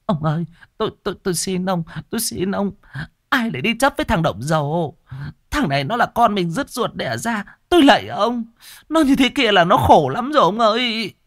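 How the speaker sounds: high, trembling plea of a desperate mother; pleading elderly mother, high and imploring. A narrator's reading. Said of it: "thương con xé lòng, vội vàng chạy lên can ngăn chồng".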